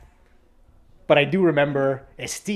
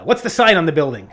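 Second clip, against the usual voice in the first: louder, insistent teacher asking; teacher grilling the student about the sign, louder and insistent; pressing teacher question; 2 semitones higher, 8 dB louder than usual. Retold or imitated. Teacher quizzing student, loud.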